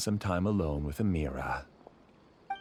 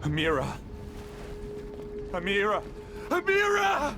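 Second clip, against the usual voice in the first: panicked calling. Acted. Father frantically calling for his daughter. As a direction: call her name in rising panic.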